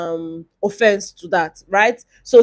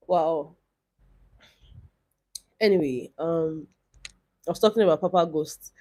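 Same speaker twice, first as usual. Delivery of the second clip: ordinary delivery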